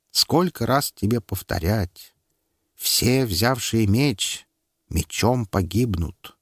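slow, patient rebuke; measured, slow warning; slow admonishing. Acted. Teacher admonishes slowly.

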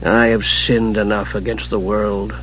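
low, soft, slow drollery; dry. A narrator's reading. Raistlin refusing to teach the kender magic, dry. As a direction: decline slowly and dryly. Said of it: "Raistlin said dryly".

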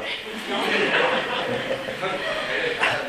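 long quiet chuckle; soft laugh. Real, not acted. Monk chuckling when the other monk is gone. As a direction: laugh gently to yourself.

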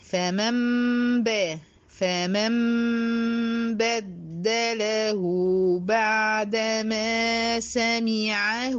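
slow chanted tajweed recitation; Quran recitation. Shown as a demonstration. Recites with iqlab. (تلاوة مجودة بطيئة)

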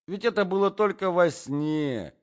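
high, loud, flustered excuse; old-fashioned gentleman crying out in protest; protesting prince. A narrator's reading. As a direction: plead loudly in your own defence.

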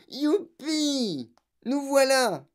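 high, drawn-out whoop; jubilant yell. A narrator's reading. Grandfather enjoys the lift's plunge, whooping. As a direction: whoop with joy as you plunge. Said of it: "Et grand-papa Joe hurla".

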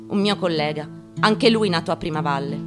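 ordinary talk, nothing marked

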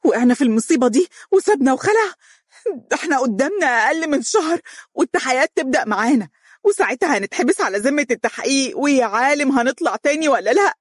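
spiral into hysteria; shrieking in terror; hysterical. Acted. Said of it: "وهي تقول بهستيريا".

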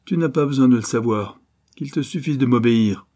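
narrated villain's command; domineering. A narrator's reading. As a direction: shut the captive down with authority. Bandit chief orders his captive.

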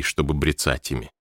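usual speaking voice

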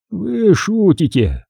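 slow, high-pitched mock disbelief; ironic. A narrator's reading. Major answering Nick's outburst, ironic. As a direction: stretch it out with irony. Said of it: "с иронией ответил майор".

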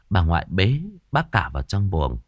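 ordinary delivery, nothing marked

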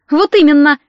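angry woman butting in loudly; sharp bark. A narrator's reading. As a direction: bark it out indignantly. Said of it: "рявкнула Виктория".

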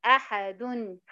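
"أحد" ending in clear -un; tanween as n. Shown as a demonstration. Teacher repeating the full form, "أحد" said ahadun. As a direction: spell out "أحد" as a-ha-dun. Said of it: "هي لو عليها تنوين بالضم".

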